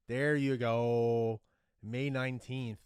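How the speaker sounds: very loud, long drawn-out cheer; triumphant shout. Real, not acted. Fan finally finding the final's date, triumphant shout. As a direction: belt it out and stretch it in triumph.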